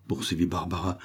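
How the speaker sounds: ordinary delivery, nothing marked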